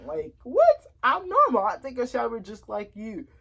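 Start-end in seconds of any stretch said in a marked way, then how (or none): 0.1-1.7 indignant disbelief. Real, not acted. Indignant at a nosy question.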